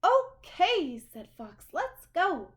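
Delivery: high, loud, breezy fox voice; a fox happily going along; high, cheerful fox voice; unfazed fox. Acted.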